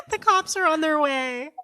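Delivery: high, loud pretend emergency announcement; mock alarm. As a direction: call it out in hammed-up panic. Pretend real emergency during the game.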